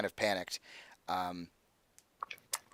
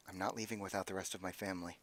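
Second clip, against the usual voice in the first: quiet defiance; 3 semitones lower, 12 dB quieter than usual. Acted. Isabel refuses to leave family.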